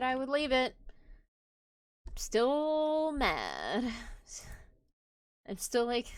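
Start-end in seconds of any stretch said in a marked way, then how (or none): 2.1-6.1 loud, very drawn-out "still mad"; real lingering anger. Real, not acted. Streamer still upset after stopping the game, real frustration. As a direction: stretch it out loudly, sulking. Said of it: "genuinely upset".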